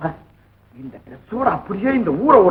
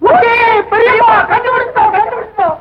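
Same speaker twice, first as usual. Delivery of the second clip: triumphant shouting; 11 semitones higher than usual. Acted. Telling uncle the riddle is solved, triumphant shout. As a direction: crow it out at the top of your pitch.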